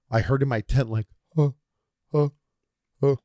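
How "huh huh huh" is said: short repeated huffs, spaced apart; echoing "huh". Retold or imitated. Retelling his trip in the tent, echoed huhs. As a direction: imitate an echo bouncing back in a tent. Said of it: "like an echo".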